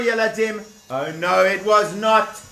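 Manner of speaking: plain reading voice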